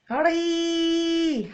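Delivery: long held ري; drawn-out syllable ri. Shown as a demonstration. Teacher modelling the syllable for children. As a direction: hold ري out clearly. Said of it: "تولي".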